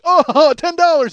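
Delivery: loud, high, laughing exclamation; mock ecstatic shout; overjoyed outburst. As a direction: burst out with hammed-up delight. George's overjoyed movie reaction acted.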